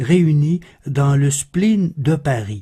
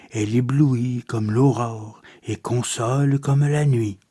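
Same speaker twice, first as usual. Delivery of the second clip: measured, softer poem reading with long pauses; poetry recitation; 3 semitones lower, 4 dB quieter than usual. Acted. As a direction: recite verse in a lower-key, solemn delivery. (récitation poétique posée)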